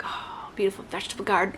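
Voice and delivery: soft whispered longing; wistful hushed whisper; dreamy whisper. Real, not acted. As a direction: breathe the dream of a garden. Daydreaming of homesteading.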